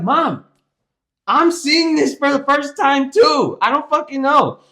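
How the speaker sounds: loud, high, exasperated shout; retold blow-up, slow and emphatic; yelling at mom. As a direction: holler at mom, out of patience. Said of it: "Stop yelling at me".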